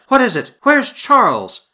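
high, loud anxious questions; alarmed cry. A narrator's reading. Wife leaping to her feet, alarmed cry. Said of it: "she cried".

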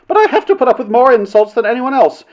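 high-pitched indignant protest; aggrieved. A narrator's reading. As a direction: complain as the much-insulted party.

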